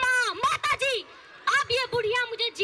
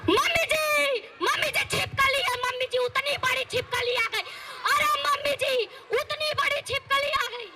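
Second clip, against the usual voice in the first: higher, frightened calling out; panicked cry; 6 semitones higher than usual. Acted. Daughter-in-law calling mother-in-law, scared of a lizard. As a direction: shriek for help at the lizard.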